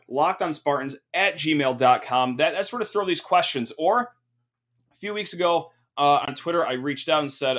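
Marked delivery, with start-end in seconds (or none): none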